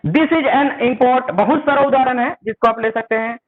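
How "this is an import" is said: very slow, word by word, as if dictating; "This is an import" drawn out word by word for students; slow dictation. Shown as a demonstration. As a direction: spell it out slowly for the class. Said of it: "चलिए आगे बढ़ते हैं".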